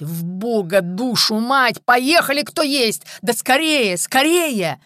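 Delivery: loud, high-pitched cursing shout; furious urging. A narrator's reading. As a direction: bellow at them to hurry. Boss swearing, rushing his men.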